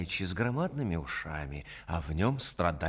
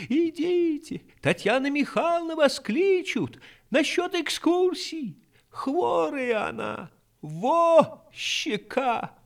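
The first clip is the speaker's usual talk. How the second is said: put-on high, loud village girl shout; shouting maid; 7 dB louder, slower than usual. A narrator's reading. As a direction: yell it across the yard, high and drawn out. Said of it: "закричала".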